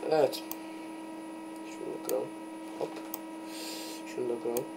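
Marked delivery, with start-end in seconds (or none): 1.7-2.9 breathy unvoiced whisper to himself. Real, not acted. Breaking a block, muttered to himself in a whisper. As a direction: whisper it as if not to wake anyone.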